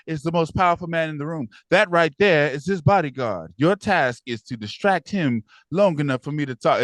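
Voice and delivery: put-on brief him like a secret agent, in her accent; mock Noma accent. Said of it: "she has that accent already".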